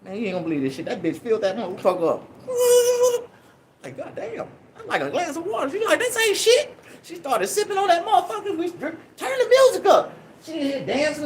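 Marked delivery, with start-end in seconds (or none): 4.0-5.7 high, hushed god damn; stunned hushed disbelief. Retold or imitated. Shocked at her huge pour. 6.0-6.8 high mock woman, dismissive. Drunk woman shrugging it off.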